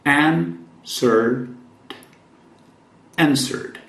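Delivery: "Answered" ending in a clear D; "Answered" with a D sound after the R. Shown as a demonstration. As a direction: finish "Answered" on a D, no W. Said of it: "depois de R tem som de D".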